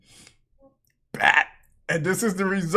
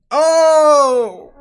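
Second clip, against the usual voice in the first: loud, higher drawn-out exclamation; shocked shout; 6 semitones higher, 8 dB louder than usual. Real, not acted. Reacting to knees being done for, shocked shout. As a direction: holler in shock at what you see.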